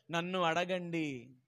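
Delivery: soft, slow invitation; gentle God's voice. Acted. God inviting his children to ask, slow and soft.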